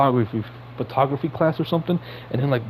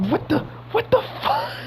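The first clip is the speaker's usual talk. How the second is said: incredulous outburst. Real, not acted. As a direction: voice cracks way up in disbelief. Exasperated rant at Aunt May.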